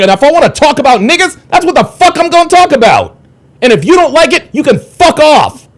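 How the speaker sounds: escalating real fury at the chat; furious yelling. Real, not acted. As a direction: let it all out, shouting him down. Rant at a chat commenter.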